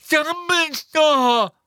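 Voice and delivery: long, high, loud joyful call; overjoyed shout. Acted. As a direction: shout his name in relief, drawn out. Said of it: "plötzlich hell erfreut". (Neville ruft erleichtert und freudig Dumbledores Namen)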